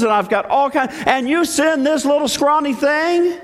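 high-pitched, boastful scorn; mocking incredulity. Goliath belittling little David, mock incredulous taunt. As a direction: scoff in exaggerated disbelief. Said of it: "he starts laughing".

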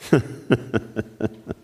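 low staccato mock chuckle; acted scoffing laugh. Saul laughing at the shepherd boy, mock chuckle. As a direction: fake a dismissive laugh. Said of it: "Saul laughs".

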